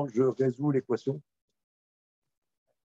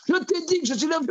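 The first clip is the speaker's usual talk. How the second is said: shrill escalation; 3 dB louder, much faster than usual. Retold or imitated.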